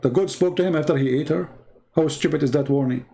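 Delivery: ordinary talk, nothing marked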